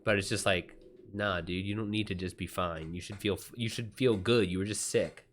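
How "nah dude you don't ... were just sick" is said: normal talking voice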